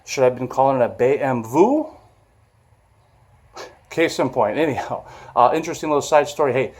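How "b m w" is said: B M W said as "bay em vay"; German pronunciation. Shown as a demonstration. Vlogger joking about his old motorcycle, B M W in German letters. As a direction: say B M W with German letter names, rising. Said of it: "that's the way to pronounce it".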